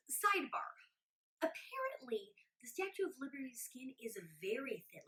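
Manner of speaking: breathy whispered aside to the camera; whispered sidebar. Acted. Whispering a sidebar to the viewers, hushed aside. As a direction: lean in and whisper the trivia.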